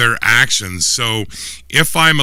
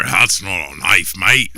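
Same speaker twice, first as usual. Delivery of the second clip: Crocodile Dundee line; 3 semitones lower, slower than usual. Pretend. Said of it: "what else is Mick Dundee known for".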